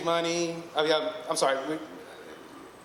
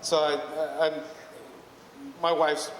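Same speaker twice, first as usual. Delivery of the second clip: usual speaking voice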